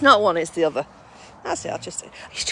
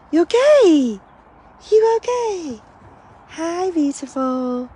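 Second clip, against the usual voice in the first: much higher, sing-song coo at the pets; 9 semitones higher, 7 dB louder, much slower than usual. Real, not acted. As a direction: coo at the dogs like babies. Cooing at the dogs.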